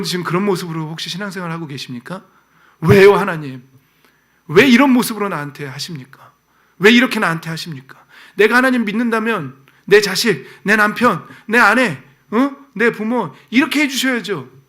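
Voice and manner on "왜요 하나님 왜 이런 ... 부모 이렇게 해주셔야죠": loud, indignant demands thrown at God; much louder, pleading and demanding; shouting protest. Acted. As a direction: cry out at God as if cheated. Voicing a believer's protest to God.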